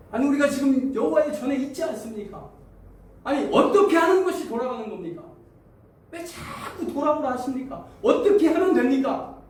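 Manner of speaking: high, loud, exasperated demands; exasperated protest. Acted. Israelites arguing with God about returning, loud exasperation. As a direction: insist you've done nothing wrong. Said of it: "이렇게 따집니다".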